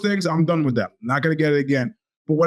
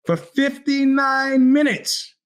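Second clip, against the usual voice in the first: For fifty-nine minutes shouted high and slow; 9 semitones higher, much slower than usual. Shown as a demonstration. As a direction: blow it up into an outraged shout.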